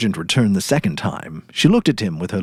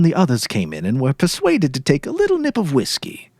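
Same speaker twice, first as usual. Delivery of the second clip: ordinary delivery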